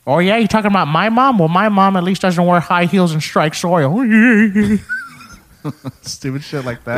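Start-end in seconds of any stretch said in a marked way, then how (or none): 0.1-3.9 loud, raised cartoon dad voice; defensive goofy cartoon dad comeback; Peter Griffin. Shown as a demonstration. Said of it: "Family Guy voices". 4.0-5.1 high, loud cartoon giggle; Peter Griffin laugh. Shown as a demonstration. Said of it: "Family Guy voices".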